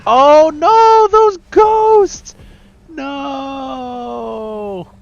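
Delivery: high, loud cry of dismay; dismayed shout. Real, not acted. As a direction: shout out in shocked despair. Losing ghosts in a live cast.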